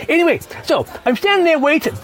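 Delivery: usual speaking voice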